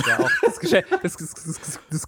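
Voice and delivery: laughing through words. Real, not acted. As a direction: breaks into laughter mid-sentence. Laughing at the toilet joke.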